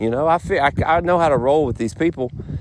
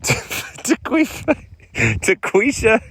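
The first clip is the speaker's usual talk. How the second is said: laughing; 9 semitones higher, much slower than usual. Retold or imitated. Mocking the name with a laugh.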